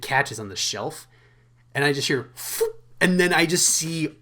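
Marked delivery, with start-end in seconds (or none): 2.3-2.8 short unvoiced puff of air; imitated whoosh. Telling friend how the fridge shelf dropped, imitated whoosh. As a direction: mimic a falling shelf with a puff.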